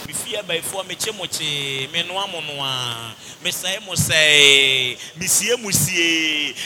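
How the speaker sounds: low, slow chanted prayer imitation; mock prayer warrior. Preacher imitates overdone binding prayers, mock chanting. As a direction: drag out each phrase like overdone prayer. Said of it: "after you do all the crazy gymnastics".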